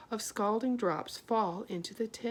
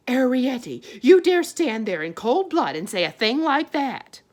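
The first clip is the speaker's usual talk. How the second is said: much louder shocked scolding; outraged mother; 5 semitones higher, 13 dB louder than usual. A narrator's reading. Homily outraged at her daughter, loud scolding.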